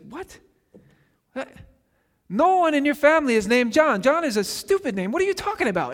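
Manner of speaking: high, incredulous outburst from the stunned relatives; shocked. Retold or imitated. Neighbours at the naming protest to Elizabeth, shocked and high-pitched. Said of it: "And they're shocked".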